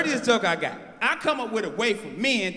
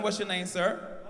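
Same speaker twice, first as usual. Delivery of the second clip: ordinary talk, nothing marked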